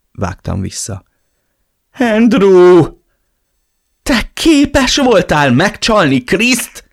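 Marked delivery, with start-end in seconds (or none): 2.0-6.9 high, loud, stunned cry of disbelief; shocked outrage. Acted. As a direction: gasp it out, horrified. Friend accuses him of cheating. (döbbent felháborodás)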